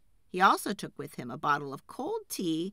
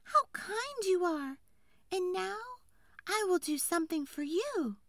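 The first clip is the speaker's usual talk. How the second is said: grateful tree fairy; 12 semitones higher than usual. A narrator's reading. Tree fairy promises woodcutter a reward, high and grateful. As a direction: speak kindly as a little high fairy.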